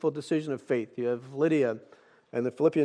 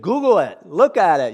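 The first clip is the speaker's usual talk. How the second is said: insistent retort; 4 semitones higher, 10 dB louder than usual. Retold or imitated. Retold insistent reply to Simon.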